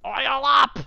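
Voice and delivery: high shrieking goblin yell; goblin shout. Acted. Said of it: "There we go".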